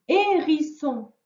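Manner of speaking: "Hérisson" said slowly, with a high, clear é; stressed first é. Shown as a demonstration. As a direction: stretch the é in "Hérisson". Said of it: "on entend bien le et". (« Hérisson » lentement, é bien appuyé)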